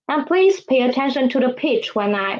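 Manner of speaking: ordinary delivery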